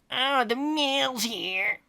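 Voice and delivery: very slow, high nerdy drawl; nerd voice. Acted. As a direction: stretch it out like a nerd. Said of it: "Dean Thomas observed accurately".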